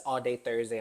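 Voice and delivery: ordinary talk, nothing marked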